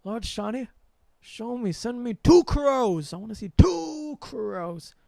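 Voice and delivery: reenacting a pleading prayer to Lord Shani; imploring prayer. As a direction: plead with the god. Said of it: "I'm, like, thinking".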